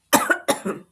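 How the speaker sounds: sudden harsh coughing; coughing fit. Real, not acted. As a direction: cough hard, choking on spit. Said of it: "Went down the wrong pipe".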